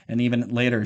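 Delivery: normal talking voice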